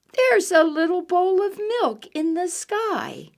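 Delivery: high, delighted kitten thought. Acted. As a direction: voice the little kitten wishing.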